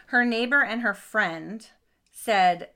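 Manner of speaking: ordinary talk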